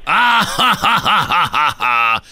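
very low character voice with a big laugh; deep, menacing comic threat after booming ha-ha-has; chiquilín. Acted. Host imitates the film's character threatening Roberto, deep impression. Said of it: "Y luego el chiquilín".

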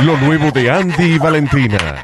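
slow, drawn-out mock album promo; hammed-up radio plug for a bachata duo; bachata group announcer. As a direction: announce it like a bachata release, laughing. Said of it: "suena como un grupo bachatero".

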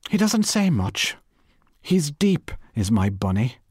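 high, proud hint of a secret; proud, meaningful. Missus Cork boasting to Bernice of her husband, high and proud. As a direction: boast of him with a knowing air. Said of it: "with alarming meaning".

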